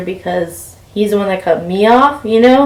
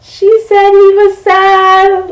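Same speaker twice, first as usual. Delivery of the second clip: high, loud, drawn-out mock wail; delusional self; 12 semitones higher, 7 dB louder, much slower than usual. Delusional self fixating on the guy's reaction, mock wailing. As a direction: stretch it out high and overdramatic. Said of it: "my delusional ass self is like".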